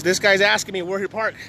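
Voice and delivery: normal talking voice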